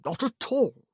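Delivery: loud, high insistence; insistent. A narrator's reading. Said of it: "Augustine insisted".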